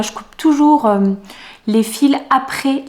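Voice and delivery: ordinary delivery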